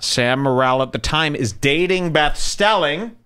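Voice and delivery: ordinary delivery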